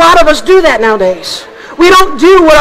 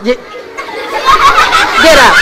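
puppet squawk; much slower than usual. Acted.

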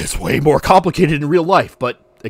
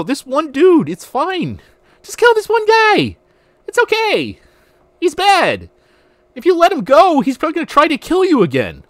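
mocking voice; 12 semitones higher, 5 dB louder than usual. Mocking the game's moral logic.

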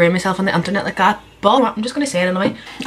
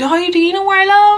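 mock scared; 11 semitones higher, 5 dB louder than usual. Scared reply to a viewer.

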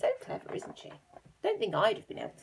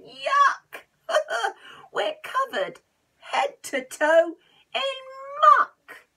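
high, loud wailing yuck; disgusted wail; 11 dB louder, slower than usual. Acted. Hugh covered head to toe in muck, loud disgusted wail. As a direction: wail in disgust, half laughing.

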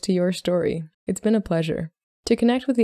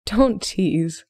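a mild scolding from the heroine; admonishing; 2 semitones higher than usual. A narrator's reading. Emmeline to Solomon at the wedding breakfast, mildly admonishing. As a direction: gently tell him off. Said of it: "Emmeline admonished".

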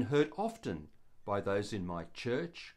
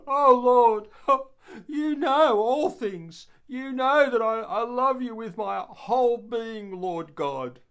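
much higher, louder, breaking into tearful pleading; crying out; 8 semitones higher, 9 dB louder than usual. Acted. Peter answering Jesus the third time, tearful and pleading. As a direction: pour out your love through tears. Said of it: "And Peter broke down and cried".

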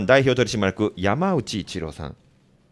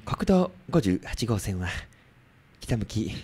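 traffic announcer impression; 3 dB louder than usual. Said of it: "知花アナ子さん".

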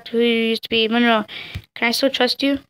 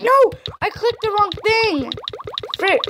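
sudden high cry of no; dismayed yelp; 8 semitones higher than usual. Real, not acted. Player misclicking a dialogue choice, high shocked yelp.

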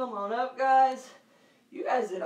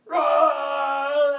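loud higher roaring shout; giant lion roar; 4 semitones higher, 11 dB louder than usual. Shown as a demonstration. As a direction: roar big for the kids. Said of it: "Five big giant lion roars".

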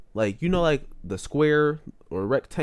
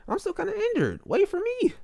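very high, mock-pitiful plea; pleading call. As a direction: beg to be waited for in a high whine. Pleading after fast-walking mother.

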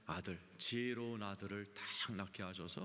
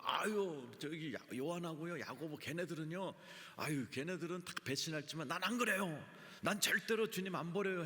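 higher-pitched, insistent Peter swearing loyalty; mock emphatic protest; 6 semitones higher than usual. Acting out Peter's boastful vow. (펄쩍 뛰며 장담하는 베드로 흉내)

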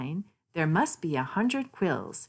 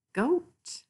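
much higher, a bleating lilt; playful high voice; 12 semitones higher than usual. Acted. Narrator names the goat to children in a playful high voice. Playful animal name reading.

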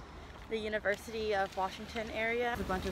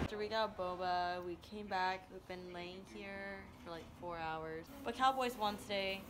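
quiet; 3 semitones lower, 11 dB quieter than usual. Real, not acted. Lying in bed tired.

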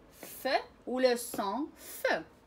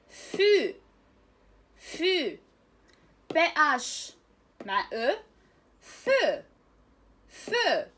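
loud, drawn-out teacher drill of each syllable; 6 dB louder, much slower than usual. Shown as a demonstration. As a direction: belt out each sound for the class to copy.

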